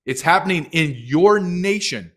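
rally them awake, loudly; loud plea. Real, not acted. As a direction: shout it to the nation. Calling out to America.

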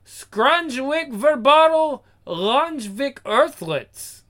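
slow, high made-up alien language; an alien's gibberish hello, drawn out high; alien greeting. Acted. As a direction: intone the alien words slowly. Alien greeting from planet Ball Bag.

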